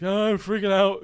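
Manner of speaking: high, loud mock freak-out; acted panic. Patient freaking out to the therapist, acted-out panic. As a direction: blurt it out in exaggerated panic. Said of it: "talked me off the ledge".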